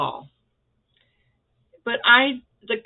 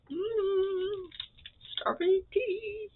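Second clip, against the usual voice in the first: delighted coo; 12 semitones higher, 4 dB quieter, much slower than usual. Real, not acted. Delighted at a lollipop.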